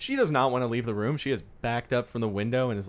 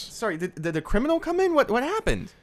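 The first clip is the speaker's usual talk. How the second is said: much higher, louder, alarmed questions; panic-ask what happened; 14 semitones higher, 3 dB louder than usual. Acted. Nurse alarmed by intruder news.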